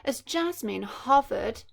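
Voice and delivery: ordinary delivery, nothing marked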